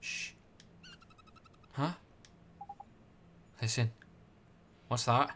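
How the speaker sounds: usual speaking voice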